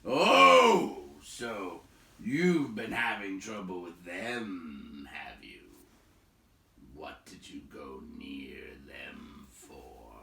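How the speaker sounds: slow hushed whisper after a loud oh. A narrator's reading. Big man softening toward the travellers, slow hushed whisper. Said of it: "less gruffly".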